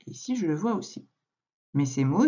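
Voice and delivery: ordinary talk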